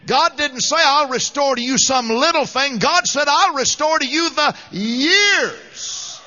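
loud, high, ringing preacher's declaration; full-force preaching. Acted. Preacher proclaiming restoration to the congregation, loud and high. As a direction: preach it out to the whole church.

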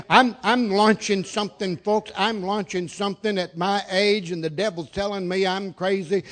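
ordinary delivery

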